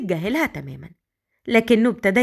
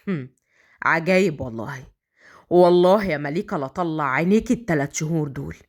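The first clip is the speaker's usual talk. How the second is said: low, disgusted male character voice; disgusted inner grumble; 6 semitones lower than usual. A narrator's reading. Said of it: "وبيقول في نفسه بقرف".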